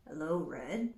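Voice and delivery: a hint of a low sly wolf; lightly voiced wolf. Wolf greeting Red in the woods, low and sly. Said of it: "said the wolf".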